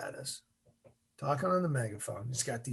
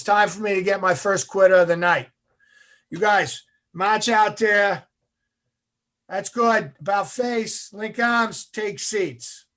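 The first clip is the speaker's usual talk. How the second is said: loud, raised-pitch military commands; drill instructor orders; 6 semitones higher, 9 dB louder than usual. Retold or imitated. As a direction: call orders down a line of trainees. Instructor barks commands at trainees.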